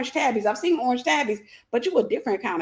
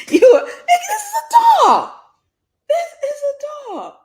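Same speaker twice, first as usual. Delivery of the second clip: much higher and louder, shrieking through laughter; laughing shriek; 9 dB louder, slower than usual. Real, not acted. She realizes her pet is a dog, shrieking with laughter. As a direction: scream it out mid-laugh in disbelief.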